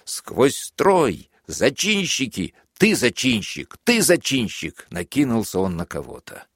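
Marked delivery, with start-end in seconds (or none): 0.0-4.9 high, slow shrieking accusations; shrill shouting. A narrator's reading. The furious major screams at the prisoners, shrill and high. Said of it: "как он визгливо кричал".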